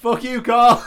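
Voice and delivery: heckler shout. Retold or imitated. Heckler again.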